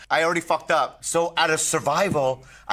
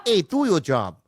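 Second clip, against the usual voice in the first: failed Boston accent; 5 semitones higher than usual. Shown as a demonstration.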